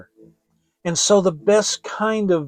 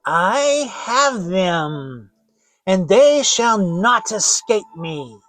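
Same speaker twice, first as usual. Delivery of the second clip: slow, louder gloating witch; triumphant witch; 4 semitones higher, 6 dB louder, slower than usual. Acted. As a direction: drag out the words in gloating triumph. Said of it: "said triumphantly".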